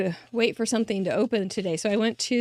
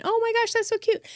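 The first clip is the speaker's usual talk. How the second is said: retold gush, pitched way up; gushing delight; 12 semitones higher than usual. Reading her cards alone in the car, gushing high. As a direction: squeal over a cute card.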